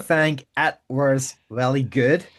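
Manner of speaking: hammed-up Northern Irish accent; mock Belfast reassurance; terrible Belfast accent. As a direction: put on a broad, bad Belfast accent. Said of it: "my terrible Belfast accent".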